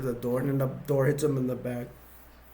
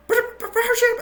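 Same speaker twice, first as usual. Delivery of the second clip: high, louder frightened squeak; scared squeak; 5 dB louder than usual. Retold or imitated. Colonel Sanders, afraid, orders ship prepared in high squeak. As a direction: squeak it out in panic. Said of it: "'cause he's afraid".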